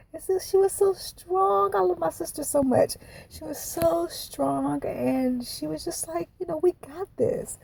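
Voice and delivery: real choked-up sister love; getting emotional. Real, not acted. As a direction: push the words out through welling tears. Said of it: "I'm about to get emotional".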